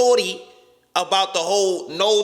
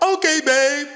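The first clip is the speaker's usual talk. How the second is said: put-on pushover boyfriend; 6 semitones higher, 4 dB louder than usual. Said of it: "my dumb ass would have been like".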